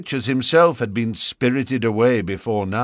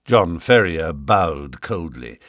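usual speaking voice

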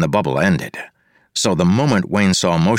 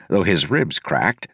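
usual speaking voice

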